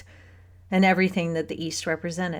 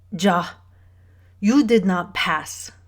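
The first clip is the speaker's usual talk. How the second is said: put-on slow, certain German-accented correction; bossy German woman, deliberate words; assertive German accent; 4 dB louder, slower than usual. A narrator's reading. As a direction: insist firmly in a thick German accent. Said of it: "There was aggressiveness in this old woman".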